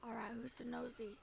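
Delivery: soft whispered question. Acted. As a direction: whisper it under your breath. Whispering while seeking an eavesdropper.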